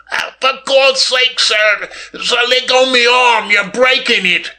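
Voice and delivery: very high, strained pleading; pained moan. A narrator's reading. Footman begs Billy Kane to release his arm, moaning. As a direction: moan in pain, begging to be let go. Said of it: "moaned the footman in a whisper".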